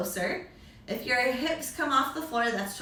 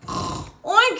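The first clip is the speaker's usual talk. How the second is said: loud, high oink; pig oink; 8 semitones higher, 8 dB louder than usual. Acted. Teacher voicing the pig loudly for the kids. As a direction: squeal out a piggy oink. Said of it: "says the pig".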